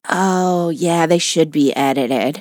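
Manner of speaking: low, slow unimpressed verdict; mock listener passing judgment; deadpan imagined listener. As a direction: drop low and drag it out. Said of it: "Do you think everyone's like".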